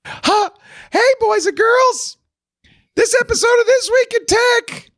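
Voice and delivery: very high, laughing, kiddie-show pitch; high squeaky voice. Shown as a demonstration. Host jokingly reads the ad in a high voice. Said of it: "talk to the whole ad like this".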